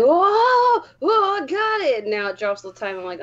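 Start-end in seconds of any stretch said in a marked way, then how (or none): 0.0-2.1 high, thrilled exclamation; excited whoa. Retold or imitated. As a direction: squeal it like a lucky drop. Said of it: "I was all like".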